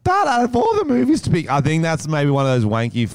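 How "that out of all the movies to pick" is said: exasperated. Real, not acted. Incredulous rant.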